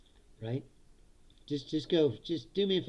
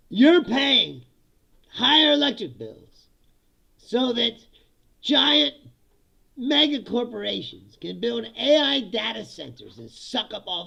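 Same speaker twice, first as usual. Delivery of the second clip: loud, raised ranting voice; loud indignant rant; angry rant; 5 semitones higher, 9 dB louder than usual. Real, not acted. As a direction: hammer the point home in outrage. Ranting about electric bills.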